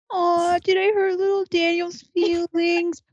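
mock baby talk. Mocking a friend's hurt feelings.

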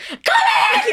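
very high imitated shriek; screaming hard. [name] switching suddenly to yelling, distressed high scream. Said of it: "He's screaming so hard".

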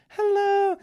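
very high, loud squeaky hello; playful falsetto call to the camera; silly camera greeting. Pretend. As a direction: chirp it in a put-on falsetto.

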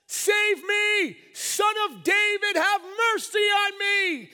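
blind beggar yelling for mercy; high, full-force cry to be heard; shouting. Acted. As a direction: shout desperately over a crowd. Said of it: "it says that he shouted".

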